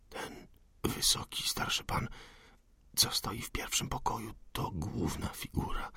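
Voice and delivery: barely voiced whisper, close to the ear; whisper in left ear. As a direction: breathe the words right into her ear. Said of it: "najcichszym szeptem". (szept do ucha)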